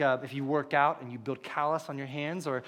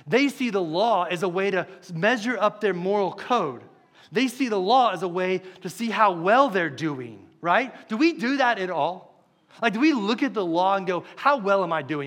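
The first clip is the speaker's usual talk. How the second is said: louder, building sermon drive; preaching at full force; 3 semitones higher, 5 dB louder than usual. Acted. Preacher to his flock, rising to a loud driving delivery. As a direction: press the point home to the congregation.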